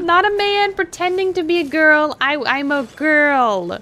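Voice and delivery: high, put-on feminine voice; girl voice. Shown as a demonstration. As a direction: play a very feminine girl, stretching the words. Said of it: "this is my girl voice".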